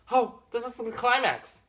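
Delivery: very high, loud excited exclamation; hammed-up thrilled cry; over-the-top anticipation. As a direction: burst out, sure the finale is here. Third, biggest false climax.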